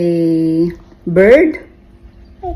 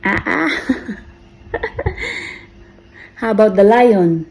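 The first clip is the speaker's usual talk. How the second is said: ordinary delivery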